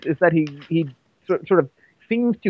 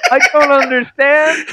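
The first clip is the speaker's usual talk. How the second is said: high, raised-voice confusion; mock bafflement; 9 semitones higher, 3 dB louder than usual. Puzzling over why both henchmen are French, mock bafflement. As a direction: cry out in playful incomprehension.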